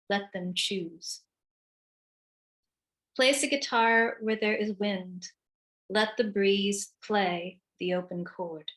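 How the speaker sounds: deliberate instruction-poem recital with long pauses; poetry reading. Acted. Poet reads instruction poems to an audience, measured recital. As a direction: give each instruction room, evenly paced.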